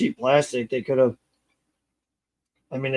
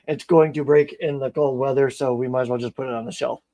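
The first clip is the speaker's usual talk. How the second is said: normal talking voice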